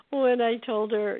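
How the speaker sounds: ordinary delivery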